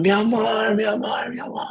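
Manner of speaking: high-pitched hoarse call, fading off; hoarse yelling. Retold or imitated. Calling to her boyfriend past the cars, hoarse screaming. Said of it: "even in my hoarse voice, I'm still loud".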